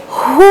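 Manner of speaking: हू with a long, held "oo"; syllable demo. Shown as a demonstration. Teacher showing how it is said, हू drawn out. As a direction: stretch the vowel of हू. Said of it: "हम इसको बोलेंगे".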